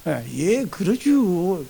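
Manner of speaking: very slow, soft mock agreement; imitating an easygoing Chungcheong reply; agreeable Chungcheong person. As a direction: stretch out a placid, noncommittal yes. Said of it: "성격상 노라고 안 한다는 거예요".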